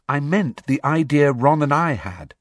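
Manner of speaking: lightly voiced young girl; Hermione voice. A narrator's reading.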